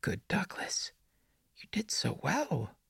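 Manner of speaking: put-on whispery, high child voice; prepubescent boy. A narrator's reading. The boy in the woods praises Douglas, in an eerie childlike whisper. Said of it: "his phlegmy, prepubescent voice".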